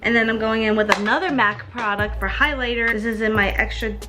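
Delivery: ordinary talk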